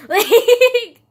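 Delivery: high, very loud burst of laughter on the word; laughing exclamation. Real, not acted. Vlogger laughs at describing what viewers can see, laughing exclamation.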